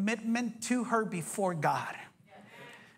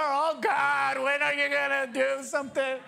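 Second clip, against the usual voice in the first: impatient complaint; 4 semitones higher, 3 dB louder than usual. Retold or imitated. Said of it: "and you're".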